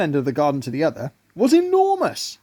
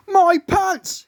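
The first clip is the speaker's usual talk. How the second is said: very high, louder squeaky ant voice; a tiny bug gasping at his pants; shocked little ant; 4 dB louder than usual. A narrator's reading.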